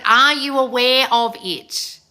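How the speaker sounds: slow, deliberate challenge to the listeners; pointed question. Real, not acted. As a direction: stretch out each word to make them think. Turns pointed question on the audience.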